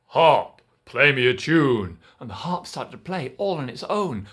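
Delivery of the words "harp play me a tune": loud booming giant voice; slow, loud giant commanding; giant's command. Acted. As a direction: order the harp like a giant. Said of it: "And the giant said".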